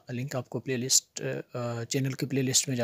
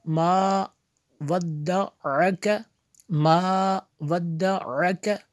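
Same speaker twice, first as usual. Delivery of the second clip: measured chant with held long vowels; slow recitation drill; 4 semitones higher, 5 dB louder, much slower than usual. Shown as a demonstration. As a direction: recite slowly, joining word to word. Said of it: "जोड़ के साथ पढ़ेंगे". (ठहर-ठहरकर लय में तिलावत)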